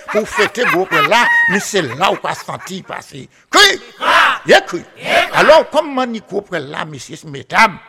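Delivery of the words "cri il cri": calling out. Acted. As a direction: throw the call out to the listeners. Storyteller's call to the audience.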